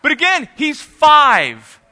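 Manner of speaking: preacher to the congregation, emphatic. Real, not acted. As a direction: drive the point home.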